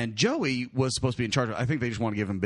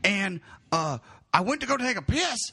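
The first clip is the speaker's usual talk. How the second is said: Joey; 10 semitones higher than usual. Retold or imitated. Keeper recounting how he lost Matt, distraught mock voice. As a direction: imitate a near-tearful report in bursts. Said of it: "He goes".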